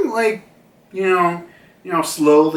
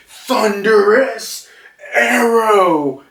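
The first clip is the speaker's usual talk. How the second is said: loud, high drawn-out battle cry; attack call; 7 semitones higher, 5 dB louder, much slower than usual. Acted. Kaido showing Yamato his version of the attack, battle cry. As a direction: bellow the name of your attack.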